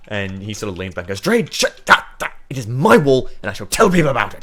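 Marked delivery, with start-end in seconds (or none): 1.2-4.4 loud, high acted outburst; hammed-up dwarf bluster; indignant dwarf. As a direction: snap back and defend your pride. Dwarf snaps back at cleric.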